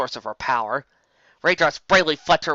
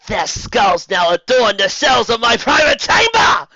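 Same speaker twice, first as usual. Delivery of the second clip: high, loud, slow evil enchantress boom; echoing villain voice filling a cavern; 10 semitones higher, 9 dB louder, slower than usual. A narrator's reading. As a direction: roar it down from on high like a sorceress. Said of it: "her voice reverberating through the enormous cavern".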